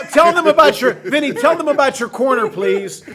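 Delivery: loud, high call across the room, laughing at the end. Real, not acted. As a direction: shout over to Vinny to tell the story. Calling out to Vinny.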